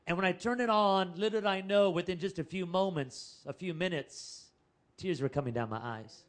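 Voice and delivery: usual speaking voice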